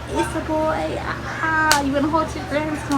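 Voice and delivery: high, sing-out cheer, imitating paramedics; excited announcement. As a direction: announce happy news in a high voice. Paramedics announcing the baby boy.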